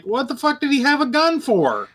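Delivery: high, exasperated disbelief; incredulous high voice. Retold or imitated. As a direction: throw it back in disbelief. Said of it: "I'm like".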